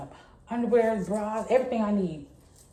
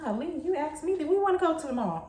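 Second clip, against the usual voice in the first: exasperated; 8 semitones higher than usual. Retold or imitated. Exasperated at paying for boyfriend.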